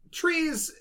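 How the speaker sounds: ordinary talk, nothing marked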